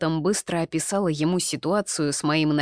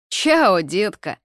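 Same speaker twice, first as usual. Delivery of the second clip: showy farewell; 3 semitones higher, 6 dB louder, much slower than usual. A narrator's reading. Drunk Robert says goodbye to heroine, calling out showily. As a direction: toss it out loudly with a swooping start.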